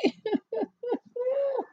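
high incredulous laugh. Real, not acted. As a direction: laugh in disbelief. Laugh after quote.

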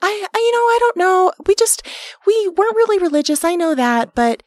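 high, breathy mother voice; a high, nervous mom justifying herself; mom impression. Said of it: "this is my impression of my mom".

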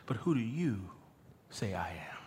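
soft, low, dropping almost to a whisper; hushed question. Acted. Jesus narrows in on his disciples, hushed question. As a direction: narrow in quietly and let it fall away. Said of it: "The hush comes when he now narrows in and says".

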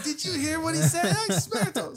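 high, loud gossiping crowd voice breaking into a laugh; impressed onlookers. Retold or imitated. Crowd in the souk cheering his comeback, high and laughing. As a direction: gush like amazed bystanders, laughing.

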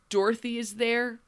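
ordinary talk

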